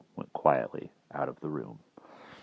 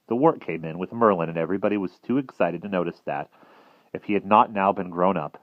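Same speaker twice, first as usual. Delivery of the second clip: ordinary delivery, nothing marked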